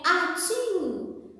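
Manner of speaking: playful spoken sneeze sound; mock sneeze. Shown as a demonstration. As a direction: act out a sneeze for the class. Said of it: "o barulho do espirro".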